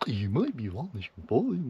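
high, loud, swooping presenter parody; weird presenter. Retold or imitated. Vlogger showing how the man talks on camera, weird presenter voice. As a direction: exaggerate a silly presenter tone, words stretched and sing-song. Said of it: "he has this sort of weird presenter tone".

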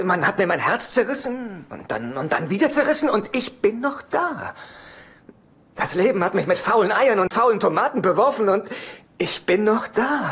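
showstopper build. Acted. Son to his mother, swelling into a grand stage finale. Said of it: "Abend mit [name]".

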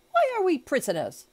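high, loud cry of disbelief; dismayed exclamation. A narrator's reading. Servant just locked up with his master, exclaiming in dismay. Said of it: "exclaimed Passepartout, falling into a chair".

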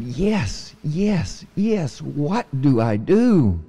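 high, slow, eager little mouse voice; a tiny mouse begging to learn. Acted. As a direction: squeak it out, so keen to begin. Eager mouse answers frog.

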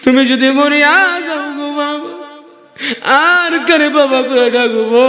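high, melodic wail for a father; child's lament. Acted. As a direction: wail like a bereaved child, drawing it out. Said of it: "আর ডাক দিয়ে বলবে". (বাবাহারা সন্তানের সুরেলা বিলাপ)

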